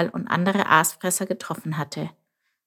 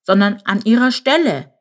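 ordinary delivery, nothing marked

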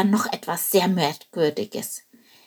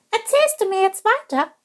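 high, light bird voice; small eager bird asking; little bird voice; 14 semitones higher than usual. Acted. Little bird Chilp asks.

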